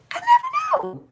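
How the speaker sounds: high wailing squeal on never; catchphrase wail. Retold or imitated. Quoting a comedian about her moodiness, high wail.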